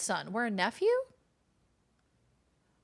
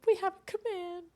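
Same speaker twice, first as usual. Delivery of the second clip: high cutesy voice; 9 semitones higher than usual. Real, not acted. Announcing a command, high singsong voice. Same cutesy call repeated.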